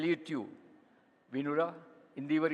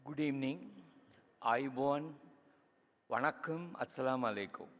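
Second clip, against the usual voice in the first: Sri Lankan accent; 3 semitones lower, 4 dB quieter than usual. Real, not acted.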